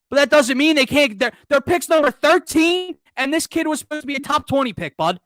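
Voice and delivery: high, loud exasperated argument; heated rant. Real, not acted. Heated rant about fan excuses.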